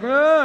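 short, high, loud moan; mock moan. Patient in the other bed calls for nurses, short mock moan. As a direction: imitate a patient moaning briefly in pain. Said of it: "hearing this guy moan".

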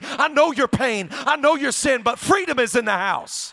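loud high preaching opener. Acted. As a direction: preach it out with full force. Preaching opening at full force.

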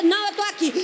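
high, loud refusal to leave; loud insistent reply. Acted. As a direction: stand your ground out loud.

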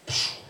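sharp "psh" of bursting water; gushing water noise. Retold or imitated. Preacher shows water springing up, imitated burst. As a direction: imitate a sudden spurt with a hiss. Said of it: "and goes".

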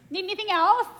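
high, lilting innocent question; hammed-up husband innocence; mock sweet. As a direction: ask it brightly, oblivious. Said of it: "he said".

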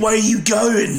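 slow, drawn-out mock British; Industrial Revolution British. Co-host playing a workman, Industrial Revolution British accent. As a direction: stretch it out in an old-time British accent. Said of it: "like, Industrial Revolution British".

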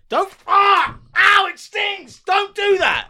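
high yelps of pain; just got slapped. Real, not acted. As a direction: yelp as if just hit. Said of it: "I'm throwing two pence pizzas at his head".

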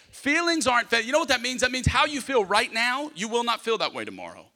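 ordinary talk

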